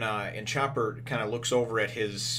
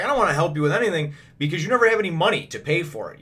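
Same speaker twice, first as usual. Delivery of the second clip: quick, higher, louder complaint; retold irritated refusal; 7 semitones higher, 7 dB louder, faster than usual. Money-minded doctor refusing a colleague who never pays, irritated. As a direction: refuse testily, imitating an annoyed character.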